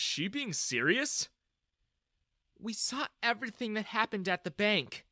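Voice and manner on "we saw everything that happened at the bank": sad. A narrator's reading. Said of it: "Jessica said sadly".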